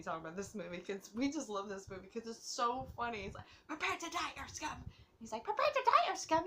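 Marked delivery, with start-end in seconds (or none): 3.7-4.8 mock alien threat. Quoting the alien invader's threat. 5.4-6.4 very high, loud cartoon threat; mock alien battle cry. Fan quoting alien to viewers, shrieked mock threat.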